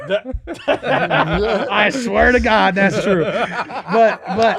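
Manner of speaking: loud, high laughter through words; laughing. Real, not acted. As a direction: laugh while swearing it's true. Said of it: "laughing after the joke".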